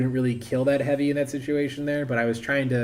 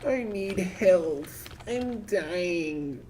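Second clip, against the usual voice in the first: drawn-out, higher plaintive whine; mock dying plea; 6 semitones higher, 4 dB quieter, much slower than usual. Gamer calls out for health in game, mock dying plea. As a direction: weakly plead for health in a higher voice.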